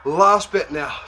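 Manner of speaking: usual speaking voice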